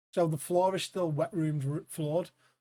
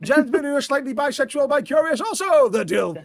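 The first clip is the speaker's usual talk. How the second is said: loud, high showman's proclamation; mock announcer; 7 semitones higher, 8 dB louder than usual. Barista addressing bi-curious gentlemen, loud announcer voice.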